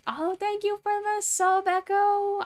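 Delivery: put-on high, slow, sweet girlish voice; high polite assistant voice. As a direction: play the gentle young assistant. Voicing Susato high and slow.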